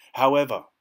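loud, firm caution; serious. A narrator's reading. Keeper adding a condition for the visitors, seriously. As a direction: announce the condition gravely. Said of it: "in a serious voice".

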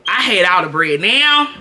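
loud, rising burst on now; loud with a big jump up on now; loud exclaim. Real, not acted. Exclaiming about past money.

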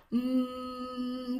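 "Mm" held long on one steady note; sonido de la M. Shown as a demonstration. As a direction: hum "Mm" as a long, isolated M sound. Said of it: "cuál es el primer sonido". ("Mm" alargado, el sonido de la M)

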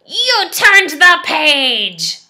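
high, loud, slow dismayed cry; he can't believe they turned it; upset Grover. Acted.

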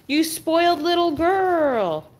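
high, drawn-out coo; teasing pet talk. Real, not acted. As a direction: tease a pampered pet, stretching the words. Cooing teasingly at her dog.

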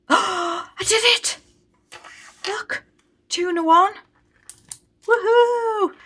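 thrilled cheer at winning the challenge; real excitement. Real, not acted. Saver cheering to viewers after cracking the code, excited squeal.

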